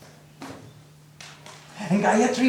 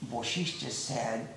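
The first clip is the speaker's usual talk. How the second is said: usual speaking voice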